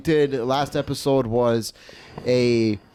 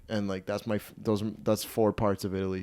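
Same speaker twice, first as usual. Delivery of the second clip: ordinary talk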